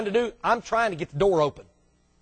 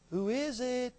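mock voice from inside; 3 semitones higher, 13 dB quieter, slower than usual. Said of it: "somebody hadn't have said".

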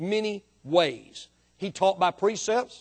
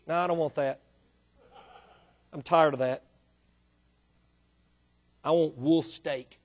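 low, softer mock refusal; a hint of a fussy sheep, pitched down; lightly voiced stubborn sheep; 5 semitones lower, 4 dB quieter than usual.

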